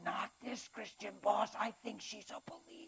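hushed, cowering minion; fearful imp. Acted. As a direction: break bad news to the boss, fading to a whisper. Imp fearfully reports to the boss.

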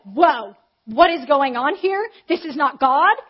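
loud, indignant imitation of an interrupter; dramatic outrage. Newcomer interrupting the group leader, dramatic outrage. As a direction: act out her alarmed, loud protest. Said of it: "she's just being so dramatic".

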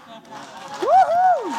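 very high, loud celebratory woo-hoo; joyful whoop. Real, not acted. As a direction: cheer it out to the congregation. Preacher celebrates testimony.

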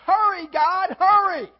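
put-on high, loud, urgent cry of a little boy; urgent child's plea. Son shouting at the ceiling, a loud pleading cry. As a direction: beg God to hurry like a scared child.

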